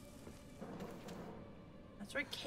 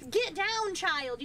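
sharp scold; 9 semitones higher, 7 dB louder, much slower than usual. Real, not acted. Scolding the in-game kid.

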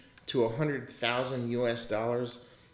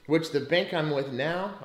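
ordinary talk

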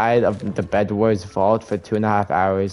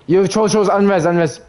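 urgent callout; 8 semitones higher, 4 dB louder, slower than usual. Real, not acted. Urgent callout mid-fight.

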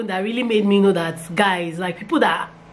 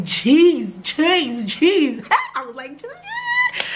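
breathless, disbelieving jeez, pitch leaping higher; stunned jeez; 7 semitones higher, much slower than usual. Real, not acted. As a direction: gasp it out in disbelief, ending very high. Seeing positive pregnancy test.

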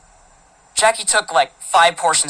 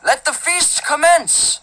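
grand proclamation, higher and drawn out; old ceremonious voice; 9 semitones higher, much slower than usual. A narrator's reading. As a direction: announce it slowly like a pompous lord of olden times. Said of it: "he did it in this old ceremonious voice".

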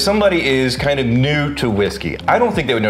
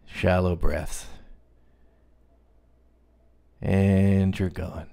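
soothing voice; 4 semitones lower, 4 dB quieter than usual. Acted. Hypnotic relaxation parody.

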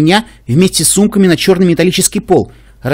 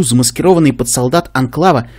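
plain reading voice

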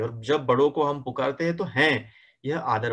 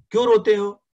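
high, loud demand; angry queen shouting high and loud; 9 semitones higher, 7 dB louder than usual. A narrator's reading. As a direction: yell it at the crying kids. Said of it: "रात रानी चिल्ला कर कहते".

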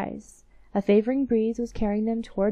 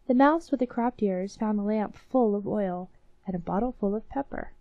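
ordinary delivery, nothing marked

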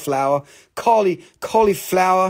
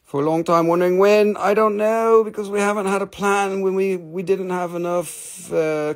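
ordinary delivery

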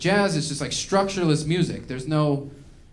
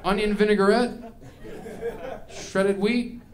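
higher, each item rising like a question; puzzled diner; 4 semitones higher than usual. Acted. Baffled diner inspecting a jazz sandwich, puzzled rising list.

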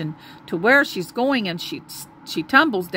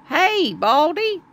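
slow high call to the eagle; shouting; 6 semitones higher, slower than usual. A narrator's reading. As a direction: call out up to the sky. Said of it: "he shouts".

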